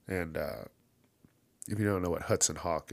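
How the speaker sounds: ordinary talk, nothing marked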